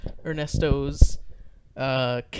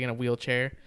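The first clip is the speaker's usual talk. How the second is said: ordinary talk, nothing marked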